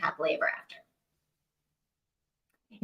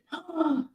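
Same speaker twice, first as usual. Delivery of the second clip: gasp; 7 semitones higher, 4 dB quieter than usual. Real, not acted. Reacting to plot twist.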